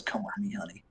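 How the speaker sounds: flirty dwarf lady. Acted. Dwarven lady leading Luke off by hand, soft coaxing. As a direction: lure him away gently.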